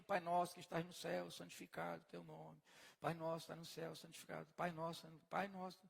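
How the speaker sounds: mumbling the Lord's Prayer by rote, trailing off; mock rote prayer. Preacher mimicking people who pray by rote, mumbled and mechanical. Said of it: "as pessoas iam ficar repetindo".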